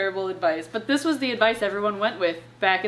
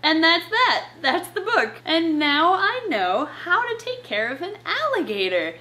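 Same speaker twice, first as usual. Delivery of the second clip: sarcastic praise; 7 semitones higher than usual. Pretend. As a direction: gush falsely over a bad book.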